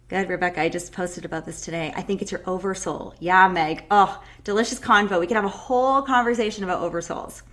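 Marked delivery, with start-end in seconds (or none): none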